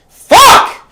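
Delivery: a sudden high screamed curse; furious outburst. Acted. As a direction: explode with one yelled word. Explosive outburst after rant.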